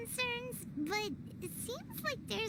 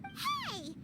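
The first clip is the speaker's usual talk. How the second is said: indignant outcry; 10 semitones higher than usual. Acted. Paimon objects indignantly.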